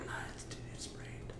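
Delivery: breathy whispered protest. Retold or imitated. To the friend on Discord, a hushed whisper. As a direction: whisper it, no voice at all.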